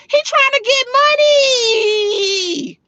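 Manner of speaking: MONEY drawn out very long, high; high-pitched jeer, holding "money" to the end; taunting stretch. Real, not acted. Lectures a broke man on real men, taunting stretch.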